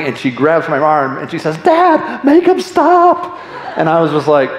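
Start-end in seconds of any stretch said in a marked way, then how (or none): 1.6-3.3 high-pitched, drawn-out mock scream of a frightened kid; screaming little girl. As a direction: imitate a crying daughter pleading on a roller coaster. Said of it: "Maddie is screaming".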